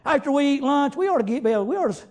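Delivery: ordinary talk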